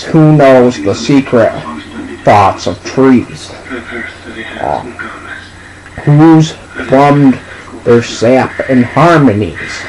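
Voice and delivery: slow, drawn-out dramatic poem reading; slow poem recitation. Acted. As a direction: linger on every line like a bard.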